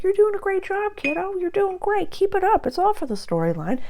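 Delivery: high, loud, sugary cheerleader voice; mock pep talk in a squeaky, condescending pitch; patronizing. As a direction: gush fake encouragement way up high, as if to a kid. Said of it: "I don't patronize. I'm not like".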